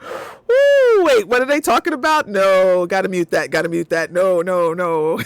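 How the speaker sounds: loud hurried mock panic when a parent walks in; loud fast flustered mock alarm. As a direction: scramble to mute in a fake panic. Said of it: "I'm just like".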